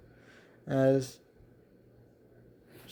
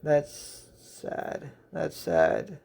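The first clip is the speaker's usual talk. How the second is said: mildly saddened, breath-led sigh; 4 dB quieter, much slower than usual. A narrator's reading. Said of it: "Jadis just sighs as she says".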